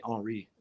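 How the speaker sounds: "Henri" said low, with a silent H; French pronunciation. Shown as a demonstration. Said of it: "That's how you say".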